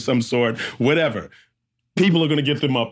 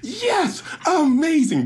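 crowd's cheer, much higher; excited crowd; slower than usual. Retold or imitated. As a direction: cheer as he makes it through. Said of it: "they're like".

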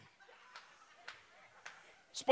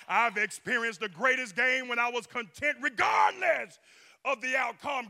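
proclaimed quote. Shown as a demonstration. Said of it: "This one of mine right here".

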